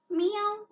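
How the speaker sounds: a big squeaky cat meow; high cat meow. Shown as a demonstration. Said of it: "which animal?".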